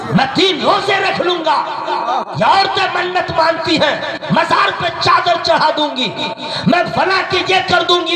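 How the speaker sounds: high, driving, unrelenting sermon voice; impassioned preaching. Real, not acted. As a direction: cry out to God before a crowd. Preaches at full force, impassioned plea.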